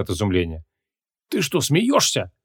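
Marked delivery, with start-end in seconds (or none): 1.3-2.3 high, slow, astonished croak; choked croak. A narrator's reading. Mage coughs with amazement at his pupil's request, croaking. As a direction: wheeze it out in disbelief. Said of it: "прохрипел он".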